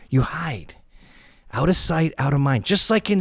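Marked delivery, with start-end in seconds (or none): 0.1-0.8 whisper. Real, not acted.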